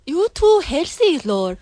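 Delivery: put-on teasing Singlish accent. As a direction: Singlish ending on "la". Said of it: "we're gonna do a Singlish accent".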